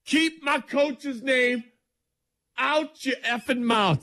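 slow, high, drawn-out mock warning; mock Rodgers rant. Sports host voicing Rodgers mock-angrily. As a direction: act out Rodgers laying down the law. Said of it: "that's what Rodgers is saying".

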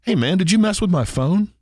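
high, suspicious buddy voice; friend's voice. Retold or imitated. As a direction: ask him like a puzzled friend. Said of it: "he was out of the car".

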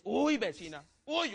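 usual speaking voice

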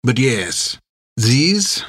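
put-on sly French-accented captain; thick French accent. A narrator's reading. Captain gestures to his crew, French accent. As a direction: speak as a French sea captain. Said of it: "a voice with a thick French accent".

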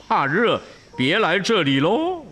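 mildly mocking street vendor voice; teasing cake seller. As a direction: tease the traveller who can't take the heat. Cake seller mocks Wukong.

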